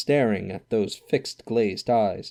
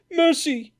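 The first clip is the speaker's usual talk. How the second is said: a much higher, louder plea for mercy; terrified cry; 5 dB louder than usual. Acted. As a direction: cry out on your knees. Scrooge on his knees begging.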